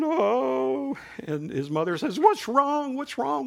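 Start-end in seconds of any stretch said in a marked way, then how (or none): 0.0-1.0 high whimpered oh, a hammed-up little boy crying; mock child crying. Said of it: "He's crying". 2.2-3.0 mother asking. Retold or imitated. Mother asks crying boy. 3.0-3.5 mother asking. Retold or imitated. Mother asks crying boy.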